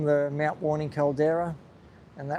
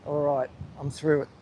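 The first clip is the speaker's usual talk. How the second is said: normal talking voice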